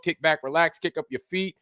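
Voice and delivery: ordinary talk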